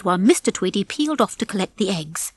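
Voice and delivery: ordinary delivery, nothing marked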